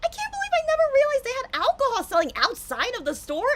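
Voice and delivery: loud, high, stunned disbelief. Retold or imitated. Tipsy streamer to viewers, recalling the fridge, in loud shocked disbelief. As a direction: shriek it like you can't believe your eyes. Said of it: "And I'm like".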